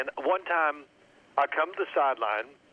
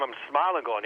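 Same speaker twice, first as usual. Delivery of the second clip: normal talking voice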